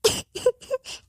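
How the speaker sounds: a high, breathy burst of sobbing; sob. Acted. New bride sobbing to her mother on the phone. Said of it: "तू रो क्यों रही है?".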